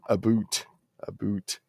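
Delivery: imitated Canadian "about"; mock Canadian accent. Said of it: "They did a lot of that in South Park".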